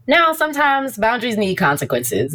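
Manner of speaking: ordinary talk, nothing marked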